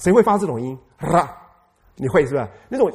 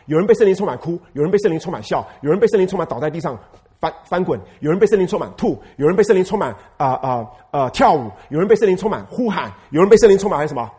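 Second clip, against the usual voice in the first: 1 rousing list; 2 semitones higher, 5 dB louder than usual. Real, not acted. Listing manifestations loudly.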